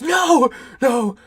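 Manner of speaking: high, loud mock cry of NO; hammed-up desperate yell; desperate denial. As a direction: shout it like a man refusing to believe. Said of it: "And Renfield goes".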